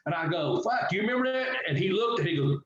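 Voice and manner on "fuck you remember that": pitched-up amazed exclamation; surprised. Retold or imitated. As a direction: blurt it out in disbelief. Surprised a fan remembers match.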